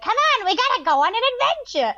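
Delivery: high, pushy cartoon bird; annoying bird voice. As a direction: squeak it out like a bossy little bird. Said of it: "And I do that voice".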